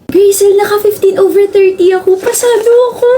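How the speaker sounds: high, giddy boast about a quiz score; excited cheer. Acted. Friend brags to Grazel about passing her quiz, giddy cheer. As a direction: squeal it like you just passed.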